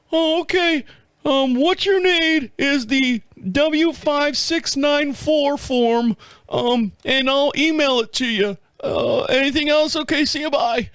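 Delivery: high, hesitant trainee voice; hammed-up first-day phone clerk; rookie dispatcher type. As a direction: fumble through it in a high, silly voice. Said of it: "like a nine one one dispatcher on his first day".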